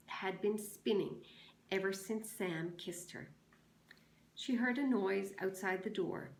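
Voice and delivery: expressive read-aloud of a novel chapter; story reading. Acted. Teacher reads the chapter to her class, expressive.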